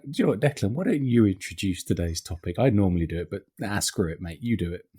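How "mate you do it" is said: normal talking voice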